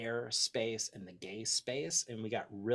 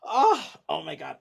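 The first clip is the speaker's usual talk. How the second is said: loud, overwhelmed cry of joy; overjoyed outburst; 5 semitones higher, 11 dB louder than usual. Real, not acted. Host reading the city list, overwhelmed with real joy. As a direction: burst out, near tears with delight. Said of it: "I'm, like, crying saying".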